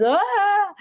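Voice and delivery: high, drawn-out "ah"; imitated toddler wail. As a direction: mimic a little one's outburst. Imitating toddler's frustrated cry.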